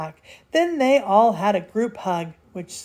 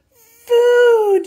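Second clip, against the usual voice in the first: very high, loud drawn-out yell; dwarves' shout; 9 dB louder than usual. A narrator's reading. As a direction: bellow it hungrily, pitched right up. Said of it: "shouted the dwarves".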